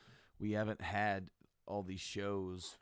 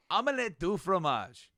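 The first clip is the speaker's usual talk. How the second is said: loud insistent; 8 semitones higher, 9 dB louder than usual. Retold or imitated. Answering the computer.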